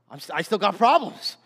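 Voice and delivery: lightly voiced protesting believer; mock complaint. Believer protesting that problems remain, lightly hammed-up complaint. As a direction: put on a bit of a complaint.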